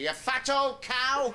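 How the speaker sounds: high, slow, drawn-out insult with a laugh; shouting back. Retold or imitated. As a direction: yell it back slowly, relishing the insult. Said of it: "So I shout back at her".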